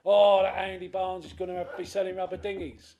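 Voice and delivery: loud, high mocking gossip; hammed-up busybody blurting a rumour; mock gossip outburst. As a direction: townsfolk on Facebook spreading gossip, loud mock outrage. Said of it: "other people jump on and go".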